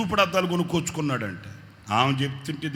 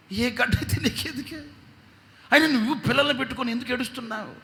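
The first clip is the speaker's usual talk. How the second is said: much higher, pressing scolding; exasperated rebuke; 10 semitones higher, 4 dB louder, faster than usual. Retold or imitated. Pastor scolding the weeping man, much higher and exasperated. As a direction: scold him for scaring the kids.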